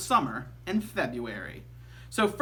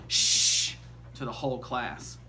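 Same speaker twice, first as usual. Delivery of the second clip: teacher shush; 6 semitones lower than usual. A narrator's reading. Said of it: "so she just said".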